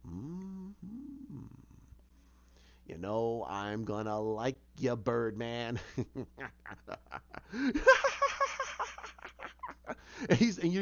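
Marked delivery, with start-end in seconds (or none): 0.1-5.8 low, slow, amused villain purr; low and slow, enjoying the moment like Joker. Retold or imitated. Joker tells Penguin he'll like him, low and amused. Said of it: "Joker's like". 5.8-9.9 high rising maniacal cackle; Joker laugh. Retold or imitated. Joker cackles after striking his deal, high and rising. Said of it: "you see him laughing".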